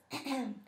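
deliberate throat clear. Shown as a demonstration. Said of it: "clear your throat like this".

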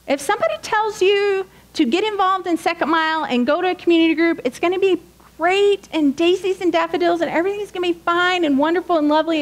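high, sing-song mock cheer; sarcastic rosy promise voice; mock sugary. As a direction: paint it as a too-sweet fairy tale. Mocking rosy promises of community.

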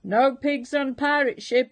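a hammed-up pirate roar; gruff shout. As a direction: bark it with finality. Captain repeats his rule.

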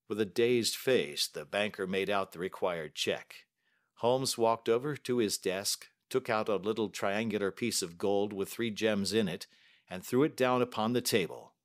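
usual speaking voice

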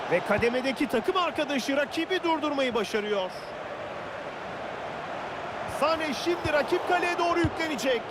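ordinary delivery

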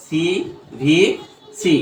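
CVC stretched very slowly, a gap after each letter. Shown as a demonstration. Teacher repeating the short-sound rule for students, slow, letter by letter. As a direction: spell out CVC slowly, separating every letter. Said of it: "আর short sound করে কিসের?".

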